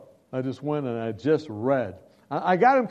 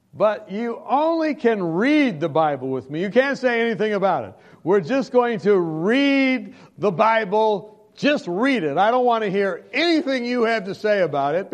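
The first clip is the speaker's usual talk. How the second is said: firm terms; 5 semitones higher, 5 dB louder than usual. Retold or imitated. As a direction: lay down the rules word by word. Man lays down firm terms.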